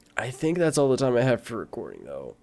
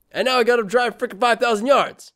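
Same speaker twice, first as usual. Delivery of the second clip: high, exasperated gripe; exasperated complaint; 10 semitones higher, 4 dB louder than usual. Real, not acted. Streamer has to drive five thousand yards, exasperated. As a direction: complain with the pitch pushed right up.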